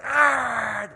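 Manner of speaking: high, loud imitated growl. Moi listeners reacting to the beggar's shout, imitated growl. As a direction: growl out a long angry "ah".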